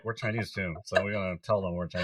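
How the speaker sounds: soft, quick undertone imitating the kids; hushed aside. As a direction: say it under your breath, like a private aside. Acting out kids' aside about heritage.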